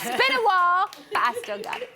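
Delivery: badly faked American accent. Host jokes to the audience after his cackle, shoddy American accent. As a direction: put on a hammy, unconvincing American accent. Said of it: "talking in a really shit American accent".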